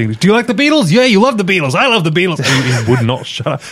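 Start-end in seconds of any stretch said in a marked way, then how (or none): 0.2-2.4 high, gushing imitation of an obsessive fan; hammed-up overexcited delivery guy; flustered. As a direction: rattle on in mock excitement, pitched up. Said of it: "very flustered".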